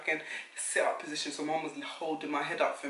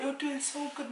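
cheering; 11 semitones higher than usual. Retold or imitated. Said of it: "He was like".

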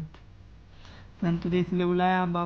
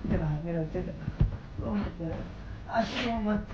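softer, tender hush to stop her crying; soft soothing; 3 semitones lower, 6 dB quieter than usual. Acted. Boyfriend gently hushing crying Aashi, soft and tender. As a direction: coax her gently, almost hushed.

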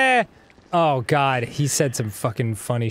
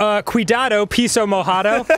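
put-on Valley Girl accent; 6 semitones higher than usual. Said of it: "Valley Girl style English accent".

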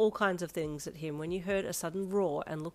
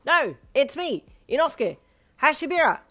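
loud, high proud announcement; brash shouted introduction; calling out; 8 semitones higher, 11 dB louder than usual. Acted. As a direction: bellow your name through the door. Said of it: "Inosuke called".

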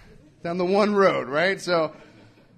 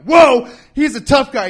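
loud mock alarm; 6 semitones higher, 11 dB louder than usual. Reacting to tough guy.

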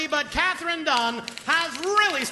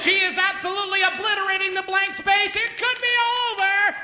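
climax call; 5 semitones higher than usual. Acted. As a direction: sell the knockout moment.

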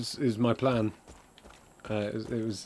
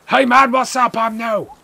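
high, loud shouted read; reading capital letters; 12 semitones higher, 13 dB louder than usual. Retold or imitated. Reading a viewer's all-caps chat message, shouted high. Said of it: "it's capital letters".

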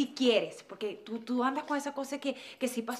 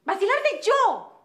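loud, high and slow, offended; indignant disbelief; 7 semitones higher, 8 dB louder, much slower than usual. Acted. Eloína to Gustavo, loud, high and slow in disbelief. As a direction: throw it back, outraged.

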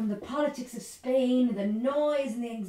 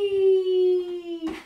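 high, loud, drawn-out squeal of glee; sustained high whee, sliding slightly down; long gleeful whee; 7 semitones higher, 5 dB louder than usual. Acted. Traveller launching into a new life, gleeful whee.

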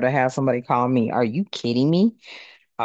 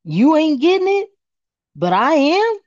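much higher and louder, retelling her disbelief; indignant outburst; 11 semitones higher, 3 dB louder than usual. Retold or imitated. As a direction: fire it off high in exasperation. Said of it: "I'm like".

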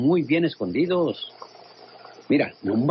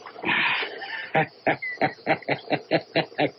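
long airy laugh at a friend's expense; a long mocking breathy laugh; 4 semitones higher, 4 dB quieter than usual. Friend mocks peg leg.

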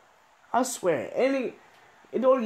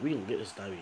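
low determined mutter; 8 semitones lower, 8 dB quieter, faster than usual. Real, not acted. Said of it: "I ain't even getting excited".

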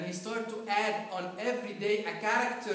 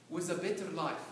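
usual speaking voice

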